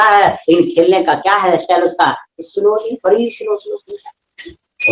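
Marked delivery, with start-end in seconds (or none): 2.4-4.9 soft, high, stretched-out playful slowly; drawn-out slowly. Shown as a demonstration. Teacher showing students the style of playing, drawn-out slowly. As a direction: draw the words out softly, up high.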